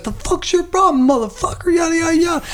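hammed-up furious driver; angry driver. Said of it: "He's like".

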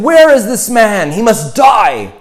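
loud high furious demand, slowing on the threat; a king roaring for the culprit's death; enraged shout. Retold or imitated. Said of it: "He was enraged".

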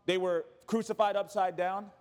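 ordinary talk, nothing marked

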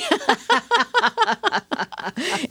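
a real laugh that climbs high. Real, not acted. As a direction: laugh freely at yourself. Laughs after joking about herself.

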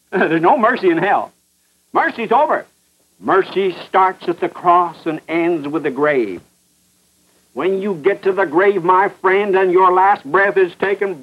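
ordinary talk, nothing marked

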